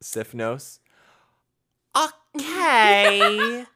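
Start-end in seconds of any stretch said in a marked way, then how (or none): 2.0-3.6 high, loud mock Greek lady; imitating Irene. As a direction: chirp it like the Greek landlady. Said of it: "Remember the lady from, um, Sifnos?".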